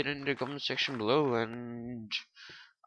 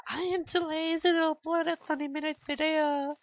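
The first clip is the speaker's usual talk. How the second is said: much higher, squeaky silly voice. Acted. Joking to viewers about laziness in a squeaky high voice. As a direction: squeak the excuse out like a cartoon. Joke about being lazy.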